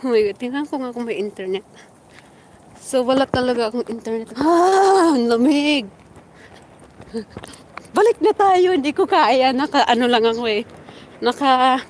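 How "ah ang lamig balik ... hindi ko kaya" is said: gasping in icy wind. Real, not acted. Ang lamig.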